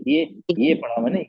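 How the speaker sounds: usual speaking voice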